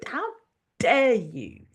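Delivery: normal talking voice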